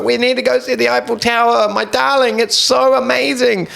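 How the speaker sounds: high, gushing mock mom. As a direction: swoon over the tower like an excited mother. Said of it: "She was like".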